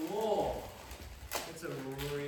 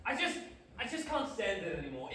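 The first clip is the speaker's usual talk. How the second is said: ordinary talk, nothing marked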